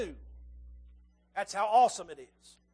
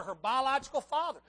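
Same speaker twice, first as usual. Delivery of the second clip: ordinary talk, nothing marked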